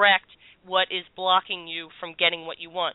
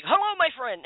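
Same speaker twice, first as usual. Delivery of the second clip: lightly imitated Spanish accent, pitched up; a higher-pitched greeting with a hint of Spanish accent; 7 semitones higher than usual. Channeled spirit greets the stunned host, light mock Spanish accent. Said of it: "he's got this Spanish accent".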